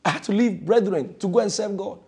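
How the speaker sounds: ordinary talk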